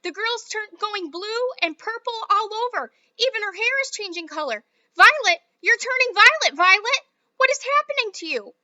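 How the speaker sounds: mother screams as Violet turns blue; screaming mother. Acted. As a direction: draw the scream out in horror. Mother yelling in panic.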